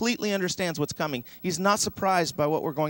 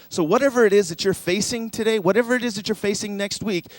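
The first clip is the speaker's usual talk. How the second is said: higher, louder, rising preacher's build to a declaration; full-force preaching; 5 semitones higher, 7 dB louder than usual. Acted. Preacher to congregation facing hard seasons, loud and soaring. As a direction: preach it out big to lift the room.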